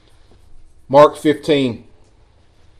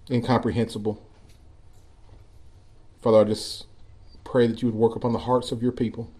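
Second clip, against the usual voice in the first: soft, lowered praying voice; prayer; 4 semitones lower, 9 dB quieter than usual. Real, not acted. Preacher to God for his people, praying softly. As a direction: pray quietly, with pauses between phrases.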